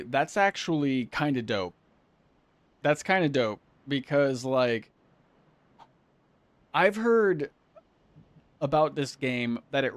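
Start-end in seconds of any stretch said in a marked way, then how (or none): none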